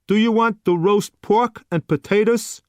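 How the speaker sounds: bored waiter pushing the last dish; gruff waiter. A narrator's reading. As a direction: waiter to customer, impatient put-on voice. Surly waiter voiced.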